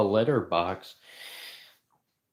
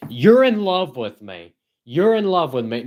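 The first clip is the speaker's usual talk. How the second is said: mock insistent; 5 semitones higher, 5 dB louder than usual. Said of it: "you're like".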